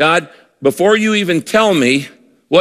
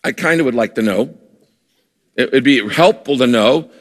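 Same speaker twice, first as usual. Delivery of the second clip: lower, halting, half-hearted asking; lukewarm request; 3 semitones lower than usual. Acted. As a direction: ask as if it hardly matters. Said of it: "It's not like".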